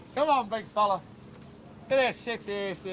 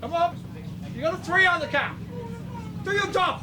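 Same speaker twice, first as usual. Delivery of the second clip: calling out; 13 semitones higher than usual. Real, not acted. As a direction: yell encouragement to a driver. Cheering a driver from the stands.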